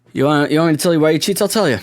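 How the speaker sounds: lightly doing Bill Burr's brash set-up; Bill Burr impression. Riffing on Bill Burr for his podcast listeners, brash and fast. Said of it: "I'm acting like Bill Burr now too".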